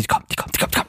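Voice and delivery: frantic repetition fading to a whisper; urgent, secretive alarm; hushed panic. Acted. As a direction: blurt it and drop to a whisper. Hurried panic before hanging up.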